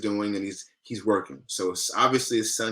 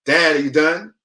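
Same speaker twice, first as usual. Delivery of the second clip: put-on higher, louder little-boy call; impatient young son; 6 semitones higher, 9 dB louder than usual. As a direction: call out to Dad like an impatient kid. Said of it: "Comes back in the room".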